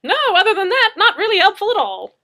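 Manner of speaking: high, loud mocking laugh through the words; laughing sarcasm. Madzeke mocks the idea to Murphy, laughing.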